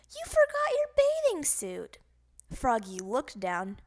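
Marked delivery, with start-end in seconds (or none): 0.1-2.0 high, loud calling voice; called-out warning. Acted. Mister Mugwort telling Froggy from afar, high loud call. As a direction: call it across the beach.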